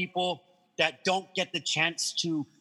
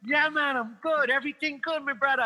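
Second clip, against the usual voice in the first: high, easy Jamaican patois; Jamaican accent; 8 semitones higher than usual. Retold or imitated. Storyteller replying to the FedEx driver, Jamaican accent. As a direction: answer back in an imitated Jamaican accent. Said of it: "the Jamaican guy goes".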